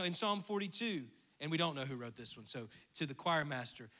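normal talking voice

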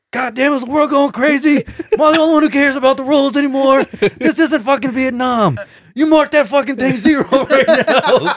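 high-pitched, hammed-up fury; mock outraged rant. Gun-waving veteran demanding a zero, mock outrage. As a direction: rant like an unhinged bowler, half laughing by the end.

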